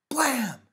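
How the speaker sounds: high, loud pretend gunshot; boy's gun noise. A narrator's reading. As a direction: shout a short explosive bang like a boy at play. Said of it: "recognized Asher's voice".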